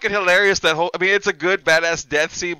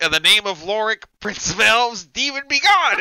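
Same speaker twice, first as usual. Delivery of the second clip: mock spell building to a higher, louder cry; hammed-up wizard incantation; 5 semitones higher, 5 dB louder than usual. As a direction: cast the spell, rising to a shout. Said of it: "he's all like".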